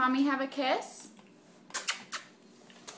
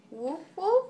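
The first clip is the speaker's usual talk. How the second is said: puppy barking; 7 semitones higher than usual. Pretend. Asked what puppies do.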